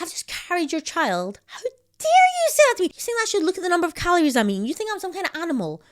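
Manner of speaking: high, loud wounded rant; hammed-up hurt indignation; mock tearful outrage. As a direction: play the offended new mum ranting. Acted tearful rant at husband.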